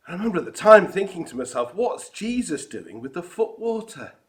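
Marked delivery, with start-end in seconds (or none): 1.7-4.1 baffled. Retold or imitated. Baffled thought remembered aloud.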